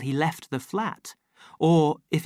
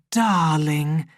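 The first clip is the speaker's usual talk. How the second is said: coaxing darling; 3 semitones higher, 6 dB louder than usual. A narrator's reading. Repeats darling, pressing harder.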